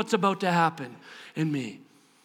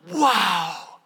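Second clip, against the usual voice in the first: awed wow; 4 semitones lower than usual. Acted. Acts Elizabeth's awe at Mary.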